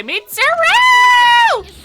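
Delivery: high drawn-out yell of a name; shouted name. Real, not acted. Streamer yelling a character's name in alarm. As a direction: call the name out long and loud.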